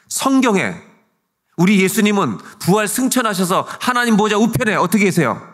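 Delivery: high, loud, impassioned sermon; full-force preaching. Acted. Pastor challenges the congregation about death in faith, full-force preaching. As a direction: drive it home to the whole congregation.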